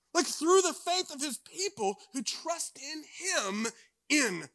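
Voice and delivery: high, loud, soaring sermon delivery; preaching. Acted. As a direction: preach it out to the congregation with full force. Preaching at full force before story.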